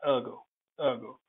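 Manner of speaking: Ergo with the "er" vowel of bird; English pronunciation. Shown as a demonstration. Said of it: "but in English".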